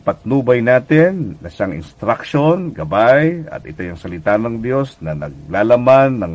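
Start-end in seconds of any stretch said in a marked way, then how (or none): none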